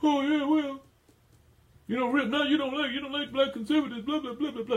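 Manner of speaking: high, loud whiny mocking voice; mock listener voice. Host mimics listeners writing in, high loud mocking whine. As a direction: sneer it like a smug listener. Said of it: "some of you will even try to write me".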